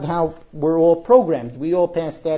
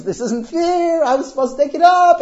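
mock wailing; 11 semitones higher, 6 dB louder than usual. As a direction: wail it like a child in a tantrum. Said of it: "starting to wail at the top of his lungs".

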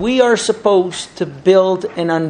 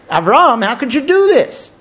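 rising, louder cry of protest; scandalized, pitch climbing as it goes; appalled reproach; 5 semitones higher, 3 dB louder than usual. Acted. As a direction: call him out, aghast. Old man's challenge grows into protest.

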